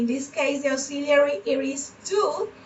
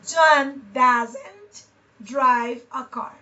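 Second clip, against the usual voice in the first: slow model; 2 semitones lower, 3 dB louder, much slower than usual. Shown as a demonstration. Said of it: "teacher models sentence slowly".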